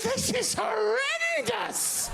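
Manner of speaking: slow laughing wail of disgust; horrified shout. Retold or imitated. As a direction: drag out the horror. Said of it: "I thought".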